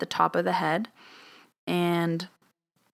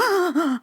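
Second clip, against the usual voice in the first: sharp high gasp of fright; startled gasp; 11 semitones higher, 4 dB louder than usual. Retold or imitated. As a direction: gasp as if the spray just went off. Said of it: "and I was like".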